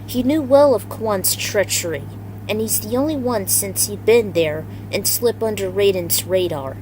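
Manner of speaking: usual speaking voice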